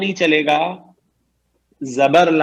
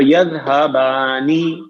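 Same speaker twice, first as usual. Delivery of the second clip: slow model word; 3 semitones lower, much slower than usual. Shown as a demonstration. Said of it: "teacher announces the new form".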